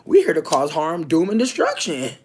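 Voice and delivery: loud, brash mock police declaration; loud hammed-up cop boast. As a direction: belt it like a smug cop. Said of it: "they were like".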